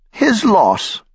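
put-on very high, trembling old woman's retort; a proud little quavering quip. A narrator's reading. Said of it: "The old woman patted her hair".

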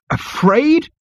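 very high-pitched rising disbelief; high, astonished echo; incredulous cry. A narrator's reading. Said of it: "cried Zaphod".

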